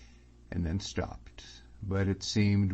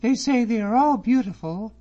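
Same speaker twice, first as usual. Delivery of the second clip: put-on high, bright-eyed girl's voice, louder; young lady's voice; 9 dB louder than usual. A narrator's reading. Miss Drummond chatting to David about the ladies, high and lively. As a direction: voice her a lot higher than the narrator.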